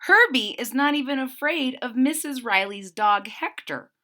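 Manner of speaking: ordinary delivery, nothing marked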